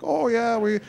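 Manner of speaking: high put-on Upper Midwest accent; Minnesota accent. Shown as a demonstration. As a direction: play a cheese-loving local in a Minnesota accent. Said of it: "a Minnesota accent".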